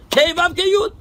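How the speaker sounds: a teacher crowing the solution to his student; triumphant reveal. Real, not acted. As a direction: announce the answer like a punchline. Triumphant reveal of reversed name.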